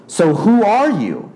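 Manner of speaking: high, slow, drawn-out disbelief; incredulous challenge. Acted. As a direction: demand an answer in disbelief. Pharisees challenging Jesus.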